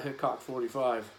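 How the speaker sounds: ordinary delivery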